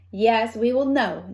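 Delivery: plain reading voice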